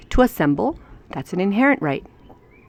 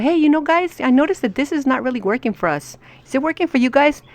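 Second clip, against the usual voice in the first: higher-pitched voice raising a concern; concerned citizen; 6 semitones higher than usual. Pretend. Citizen asks the group at a meeting, concerned higher voice. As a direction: tentatively sound out the group.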